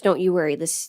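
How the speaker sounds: ordinary delivery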